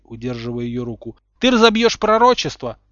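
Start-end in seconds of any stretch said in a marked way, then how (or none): 1.4-2.8 loud, high cry of warning; raised, urgent voice; alarmed shout. A narrator's reading. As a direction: shout it to stop her. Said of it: "крикнул Малфой".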